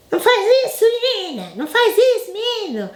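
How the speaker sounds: high, shrill scolding parent; mock scolding. Parent scolding a child who did wrong, high mock scolding.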